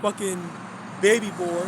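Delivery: ordinary delivery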